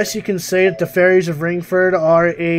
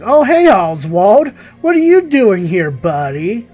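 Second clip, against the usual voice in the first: loud, higher-pitched mock hail to an old pal; playful greeting; 4 semitones higher, 9 dB louder than usual. Player greeting Oswald as he turns up, playful loud greeting. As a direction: call out brightly to a buddy, stretching the name. Greets game character appearing on screen.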